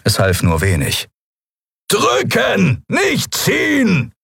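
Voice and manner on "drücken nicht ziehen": high, slow shouted instruction; bellowing. Acted. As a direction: bellow over the wind. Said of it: "brüllte er". (laut brüllend, langsam)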